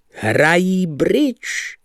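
contemptuous. Acted. Lebeda's contemptuous answer.

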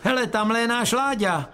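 spot a relative and call it out high; mock call from upstairs; calling from above. A narrator's reading. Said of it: "ozvalo se najednou z hora".

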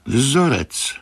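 "Vzorec" croaked high, falling at the end; a high little croak on "Vzorec". A narrator's reading. Grenouille repeats the master's word, "Vzorec" in a high falling croak. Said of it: "zakrákoral Grenouille".

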